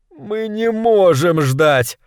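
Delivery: high, drawn-out cooing whine; hint of hysteria. A narrator's reading. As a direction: coo it desperately, stretching the words. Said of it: "с ноткой истерики проворковал Милт". (с ноткой истерики)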